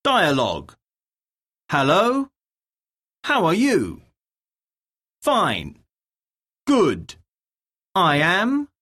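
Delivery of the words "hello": normal talking voice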